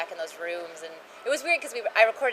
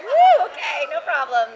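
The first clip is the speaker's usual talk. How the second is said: relieved woo; 7 semitones higher, 10 dB louder, slower than usual. Retold or imitated. Relieved outburst retold.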